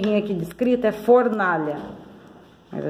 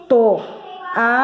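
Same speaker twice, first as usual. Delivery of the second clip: to-a-lha with clear gaps between syllables; 3 semitones higher than usual. Shown as a demonstration. Teacher counting syllables for the class, syllable by syllable. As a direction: pronounce "Toalha" as three separate beats. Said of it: "três sílabas".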